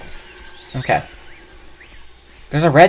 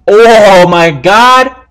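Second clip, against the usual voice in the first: drawn-out excited exclaim; 6 semitones higher, 10 dB louder, much slower than usual. Real, not acted. Finds something he can open.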